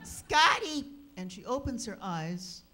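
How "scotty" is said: high, loud yell of the dog's name; mock yell at the pesky dog. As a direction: bellow the dog's name in exasperation. Yelling at the dog.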